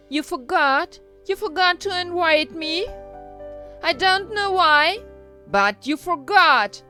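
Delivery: loud, high, accusing fairy; Maleficent's indignant speech. A narrator's reading. Evil fairy rebukes the Majesties for not inviting her, indignant.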